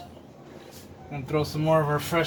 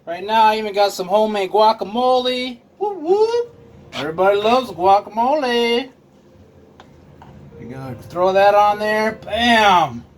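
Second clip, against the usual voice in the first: louder, higher excited host; pumped up about the food; 5 semitones higher, 5 dB louder than usual. Real, not acted. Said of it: "I'm getting extra excited".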